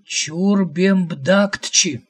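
drawn-out name. A narrator's reading. Prophet slowly saying the god's name to the visitor, drawn out. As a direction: spell out "Чурбембдактчи" syllable by syllable.

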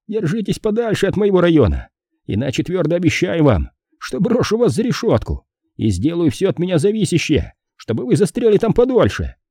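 high-pitched, insistent warning; threatening policeman. A narrator's reading. Policeman threatens detective in story. (угрожающий полицейский, высокий голос)

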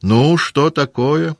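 high, drawn-out prompting question; Kutuzov. A narrator's reading. Commander prompts a petitioner to speak, drawn-out questioning.

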